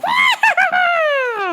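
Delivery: long high shrieking cackle; manic cackle. Retold or imitated. She comes in the store, manic high cackle. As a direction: mimic a crazed, shrieking laugh. Imitates woman in traffic.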